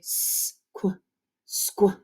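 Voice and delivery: whispered "Squa" then voiced "squa"; blend demo. Shown as a demonstration. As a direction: sound out "Squa" softly, then say it. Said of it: "So we say".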